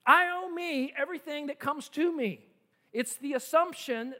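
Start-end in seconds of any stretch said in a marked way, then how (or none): 0.1-2.4 high, loud, smug self-claim; high, loud, self-satisfied greed. Acted. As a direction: puff up like greed itself. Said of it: "Greed says".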